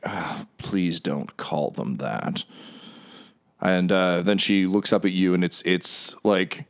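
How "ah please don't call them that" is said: low, soft, slow aside; weary Duke. Acted. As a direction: sigh it out like a tired noble. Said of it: "you can hear the Duke say".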